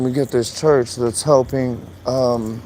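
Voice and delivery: ordinary talk, nothing marked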